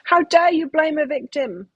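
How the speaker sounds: imitating an indignant person who refuses the blame; indignant victim. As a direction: throw back the offended protest in a mildly hammed-up way. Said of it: "They're like".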